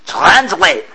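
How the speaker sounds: captain ordering a translation; ordered. A narrator's reading. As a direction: snap out the order. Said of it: "LeBlanc ordered".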